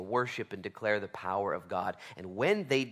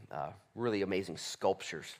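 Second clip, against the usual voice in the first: ordinary talk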